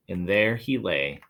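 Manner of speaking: plain reading voice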